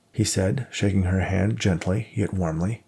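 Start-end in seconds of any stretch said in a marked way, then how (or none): none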